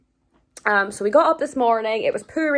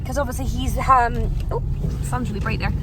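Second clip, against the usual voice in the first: ordinary delivery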